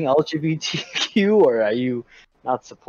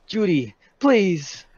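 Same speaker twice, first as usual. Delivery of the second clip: slow, higher-pitched mock plea; pleading; 6 semitones higher, much slower than usual. Nick begging Judy to stay, pleading. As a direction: beg her, drawing it out.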